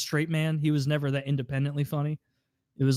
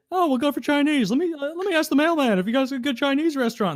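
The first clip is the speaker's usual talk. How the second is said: higher, lightly put-on voice; imitating the character; 11 semitones higher than usual. Friend retells a Seinfeld scene in a lightly higher voice. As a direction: quote him with a lift. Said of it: "where he's like".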